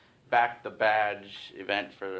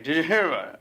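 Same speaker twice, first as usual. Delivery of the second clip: mumbled cashier; 6 semitones higher, 6 dB louder, much faster than usual. Retold or imitated. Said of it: "I cannot understand a word this guy's saying".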